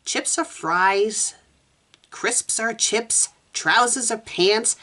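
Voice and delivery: slow, halting recitation; slow reciting. Acted. Meg reading British terms from the dictionary, slow reciting. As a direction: read each entry out slowly and separately.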